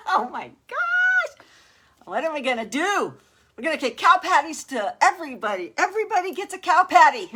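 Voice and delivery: high, loud, giddy exclaiming; real thrilled excitement. Real, not acted. Streamer celebrating her first stars party, loud giddy excitement. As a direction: whoop it out, overjoyed and nearly laughing.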